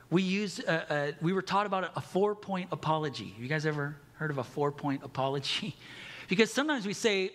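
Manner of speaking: usual speaking voice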